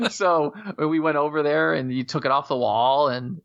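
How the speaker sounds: ordinary delivery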